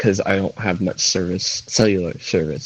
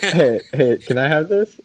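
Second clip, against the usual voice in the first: playful voiced plea; 6 semitones higher, 5 dB louder, slower than usual. Voices her asking God.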